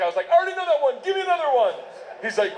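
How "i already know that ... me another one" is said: high, excited demand; eager learner. Acted. Student asking Russian friend for another word, eager. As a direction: play an over-keen pupil, pitched high.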